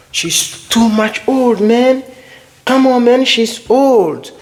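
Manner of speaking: high-pitched mock exasperation; playful, hammed-up protest; acted protest. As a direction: exclaim it high, as if complaining to a mate. Acted exclamation using the example word.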